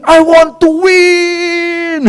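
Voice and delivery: high, slow, drawn-out cry to win; mock scream. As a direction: stretch it out like a greedy child. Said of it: "I was screaming".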